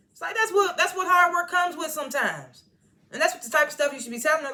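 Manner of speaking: high-pitched, exasperated objection. Real, not acted. Exasperated reaction to retold line.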